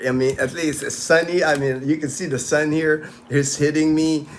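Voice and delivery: usual speaking voice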